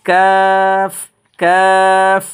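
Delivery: কাফ stretched to three alif lengths; drawn-out Kaf. Shown as a demonstration. Teacher showing students the stretched Kaf. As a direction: draw out কাফ long and even. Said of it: "তিন আলিফ করে টানবেন".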